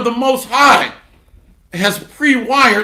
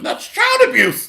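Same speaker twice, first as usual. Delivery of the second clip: much higher mocking cry of child abuse; mock shriek; 13 semitones higher than usual. Preacher mocks the devil agreeing, high squeal. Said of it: "mocking the devil's agreement".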